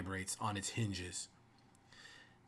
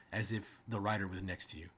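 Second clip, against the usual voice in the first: usual speaking voice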